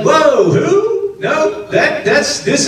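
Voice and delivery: jolted back in alarm; alarmed surprise. Retold or imitated. Ananias balking at Saul's name.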